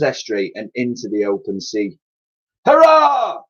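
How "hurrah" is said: much higher, loud cheer; cried hurrah. A narrator's reading. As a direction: cheer like jubilant old sailors. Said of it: "cried the pensioners".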